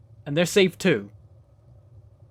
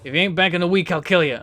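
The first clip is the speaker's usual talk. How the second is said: lightly done Jersey threat; a casual Jersey mobster threat; Jersey boss warning the newbie; Jersey accent; 4 dB louder than usual. Acted. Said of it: "I had to do the accent".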